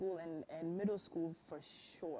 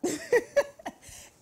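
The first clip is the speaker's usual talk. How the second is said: bursts out laughing high; a loud squealing laugh; 15 dB louder than usual. Real, not acted. Laughs at the question.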